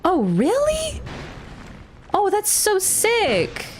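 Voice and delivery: high, slow delighted gush; drawn-out thrilled "so sick"; excited. Real, not acted. Delighted by new item.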